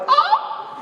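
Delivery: a very high yelp of pain with a laugh; pained scream. Retold or imitated. Retelling the ball bending his foot, high pained cry. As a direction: squeal as the foot bends back. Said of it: "and I was like".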